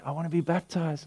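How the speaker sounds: put-on hushed, far-off voice of a young convert; quiet earnest request; soft quoted young person. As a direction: speak it softly like a shy teen. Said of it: "coming to me and saying".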